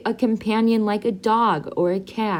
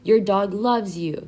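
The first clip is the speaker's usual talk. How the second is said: usual speaking voice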